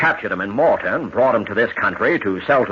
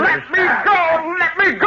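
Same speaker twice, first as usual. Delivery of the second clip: panicked struggle. Acted. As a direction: fight against the hands holding you. Captured sailor struggling.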